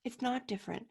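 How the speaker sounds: whispered, no voice; hushed whisper to drive the point home. Real, not acted. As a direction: lean in and whisper it. Repeated for emphasis.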